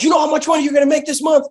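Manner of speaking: high, loud and fast, shouting the question; hyped mock shout. Manager yelling his question at the rookie, high and fast. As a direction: blast the question out high and quick.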